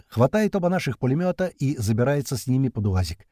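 usual speaking voice

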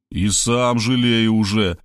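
ordinary delivery, nothing marked

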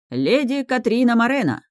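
high, ringing herald call; grand entrance announcement. A narrator's reading. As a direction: announce her name to the hall. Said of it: "торжественно объявил церемонимейстер".

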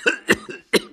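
put-on old man's hacking cough; acted elderly coughing fit. A narrator's reading. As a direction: break into a helpless cough. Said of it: "und er hustete".

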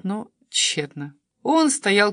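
ordinary delivery

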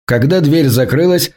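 ordinary delivery

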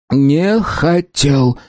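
slow, halting, each syllable apart. A narrator's reading. Terrified shopkeeper to the hamsters, forcing out syllables. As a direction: force out the words one syllable at a time. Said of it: "проговорил он по слогам".

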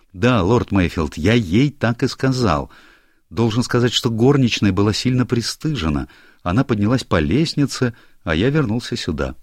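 a hint of an anxious young clerk; lightly voiced young secretary. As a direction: play the flustered secretary explaining himself. Secretary's account.

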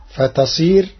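normal talking voice

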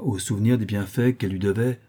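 ordinary delivery

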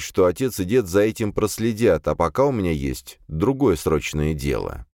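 usual speaking voice

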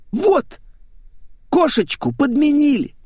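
put-on very high girlish lament; distraught blonde. A narrator's reading. Blonde declares her car swapped, distraught. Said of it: "заломила руки Светик".

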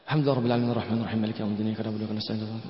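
muttered racing recitation, words blurred together; rushed mumbled recitation. Shown as a demonstration. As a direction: rattle off the Fatiha very fast and low, swallowing the words. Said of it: "بتقرأها كده".

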